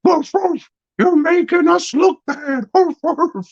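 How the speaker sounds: very high yappy dog talking; silly high talking dog mocking her; barking dog voice. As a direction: bark the words like a cartoon dog. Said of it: "It's like".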